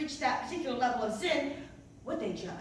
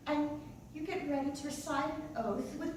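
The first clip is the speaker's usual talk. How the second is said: ordinary talk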